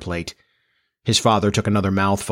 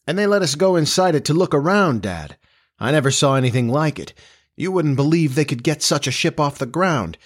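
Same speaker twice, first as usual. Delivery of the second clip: put-on higher, quick boyish enthusiasm; excited young man in a raised pitch; eager son, excited; 5 semitones higher than usual. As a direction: gush to your dad about the ship.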